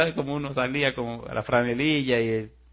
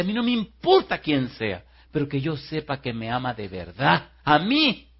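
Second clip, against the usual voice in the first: higher, louder quoted woman's declaration; emphatic insistence; 3 semitones higher, 5 dB louder than usual. Retold or imitated. Woman declares what love she wants, emphatic. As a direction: insist hard, punching it out.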